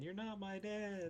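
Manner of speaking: high, slow sulky retort; petulant creature voice. Acted. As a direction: whine it like a stubborn kid. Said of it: "Octod doesn't know what to say".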